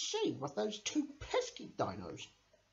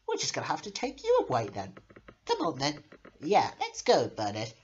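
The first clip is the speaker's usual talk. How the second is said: usual speaking voice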